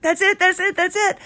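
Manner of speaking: high, thrilled "that's it" bursts; excited recognition. Retold or imitated. Fangirl recognizing her favorite song, excited squeal. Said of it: "I was so excited".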